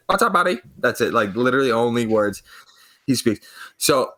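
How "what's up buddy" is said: imitating a high-pitched, chirpy greeter; security guard's greeting. Retired cop security guard greeting whoever walks in, high-pitched mimicry. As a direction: put on a much higher voice for a stock hello. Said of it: "He's like".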